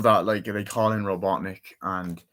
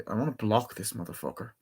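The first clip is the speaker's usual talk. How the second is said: ordinary delivery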